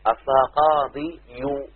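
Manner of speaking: قاضي يو drawn out, the final يو spelled out slowly; slow underlying form. Shown as a demonstration. Teacher showing his class the full underlying form, slowly. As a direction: lay out قاضي يو syllable by syllable. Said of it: "يبقى عندنا قاضي".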